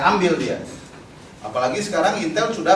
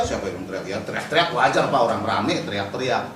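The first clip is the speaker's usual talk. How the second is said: lower, dismissive retort; savvy activist; 6 semitones lower than usual. Retold or imitated. Savvy activist rebutting the police interrogator, low and unbothered. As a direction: brush off the charge, imitating a detainee. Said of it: "Tapi kalau di aktivis yang jago".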